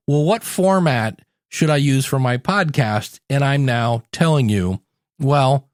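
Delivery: usual speaking voice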